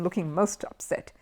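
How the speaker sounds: plain reading voice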